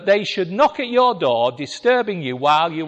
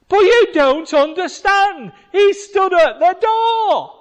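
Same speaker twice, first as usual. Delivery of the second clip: high, loud, slowed put-on plea; hammed-up frantic Rhoda; desperate girl; 12 semitones higher, 9 dB louder, slower than usual. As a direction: beg them to listen, drawn out.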